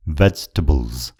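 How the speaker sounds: slow, careful VEJ-tuh-bulz for Vegetables; correct pronunciation of vegetables. Shown as a demonstration. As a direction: model Vegetables slowly in three syllables, no middle vowel. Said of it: "सही उच्चारण हो". (Vegetables लाई बिस्तारै भेज्-ट-बल्ज भनेर सही उच्चारण)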